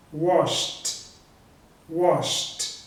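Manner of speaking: "washed" as one syllable ending in SHT. Shown as a demonstration. Teacher modelling the past tense, "washed" ending in T. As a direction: finish "washed" with SH then a clean T. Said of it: "com som de T".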